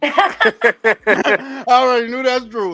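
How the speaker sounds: slow, laughing through the words; drawn out and laughing. Real, not acted. Teasing about girl in background.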